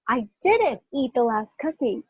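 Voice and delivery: "didn't" punched above the other words; stress on DIDN'T. Shown as a demonstration. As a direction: lean on "didn't" in denial. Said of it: "If I'm emphasizing didn't".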